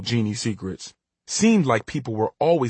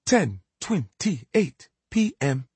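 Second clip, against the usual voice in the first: slow talking clock announcement; robotic clock voice; 5 semitones higher, much slower than usual. Acted. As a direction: drag it out like a machine. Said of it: "a low robotic voice".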